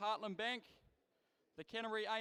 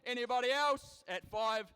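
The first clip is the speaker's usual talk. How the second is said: rapid rhythmic auction chant, punching prices; auctioneer; 3 semitones higher, 8 dB louder than usual. Acted. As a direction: rattle off bids like a livestock auctioneer. Live cattle auction bid calling.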